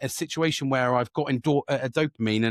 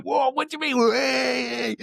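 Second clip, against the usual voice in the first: high, drawn-out excited whoop; excited child; 12 semitones higher, much slower than usual. Retold or imitated. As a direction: whoop it like an overexcited kid. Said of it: "Like a child".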